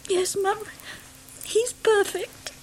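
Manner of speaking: tearful joy. Acted. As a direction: voice breaking with happy tears. New mother tearful with joy.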